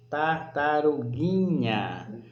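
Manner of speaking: "Tartaruguinha" stretched slowly, long on the "gui"; stress on GUI. Shown as a demonstration. Teacher confirming the tonic syllable, stretched and stressed. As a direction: draw out "Tartaruguinha", leaning on "gui". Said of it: "É justamente o gui".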